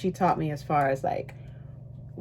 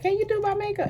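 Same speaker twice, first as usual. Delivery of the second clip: much higher, whiny mock request; mocking a pushy asker; faster than usual. Mimicking people who beg her to do their makeup, high and whiny. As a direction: imitate the people who keep asking. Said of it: "When people be like".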